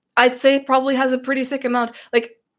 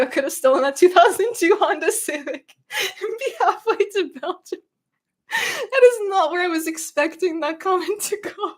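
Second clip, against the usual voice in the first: high, breaking up with laughter; laughing; 7 semitones higher than usual. Real, not acted. Streamer cracks up at a chat comment, laughing. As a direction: struggle to get the words out through laughter.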